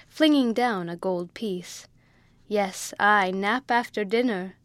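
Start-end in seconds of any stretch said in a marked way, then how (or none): none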